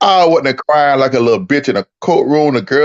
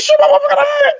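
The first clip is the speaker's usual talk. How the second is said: very high mock-wailing; hammed-up tearful falsetto; retold crying. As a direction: imitate a squealing, blubbering protest. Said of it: "I was crying like a bitch".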